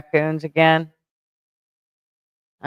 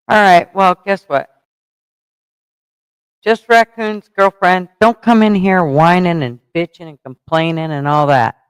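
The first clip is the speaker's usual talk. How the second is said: loud, raised-pitch telling-off; scolding; 3 semitones higher, 6 dB louder than usual. Real, not acted. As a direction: lay down the law, louder and higher. Telling off a complainer.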